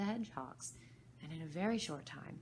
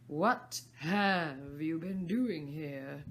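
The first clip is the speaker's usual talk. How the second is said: imperious Queen; 8 dB louder, much slower than usual. Acted. Queen interrogates gardeners.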